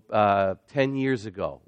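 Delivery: ordinary talk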